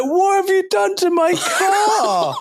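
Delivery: put-on very high, slow, squeaky old man; mock shocked old man. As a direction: squeak it out like an outraged pensioner. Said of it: "And he's like".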